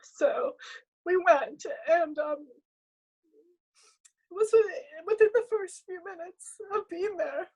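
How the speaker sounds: high, tight voice crying through the words; fighting back tears, pushing through; real tears while telling the story; ugly cry. Real, not acted. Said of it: "it's like an ugly cry".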